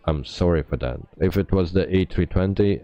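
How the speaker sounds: normal talking voice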